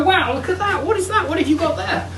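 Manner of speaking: high, wide-eyed pub mates gasping at the car; amazed mates. Pretend. Pub mates admiring the Jag, high amazed voice. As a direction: play the mates gawping at your Jag. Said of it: "and your mates go".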